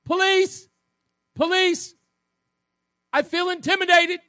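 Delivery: high, loud hammed-up panic; mock cry for police. Host mocking Tong calling police, high loud fake panic. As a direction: flag down a cop like a scared coward. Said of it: "he's calling a cop, waving him down".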